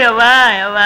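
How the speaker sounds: mock-sobbing wail for mother; wailing sob. Son wails for his dead mother, sobbing. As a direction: burst into wordy sobs, calling for mother. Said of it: "ஏன்டா அழுகுற?".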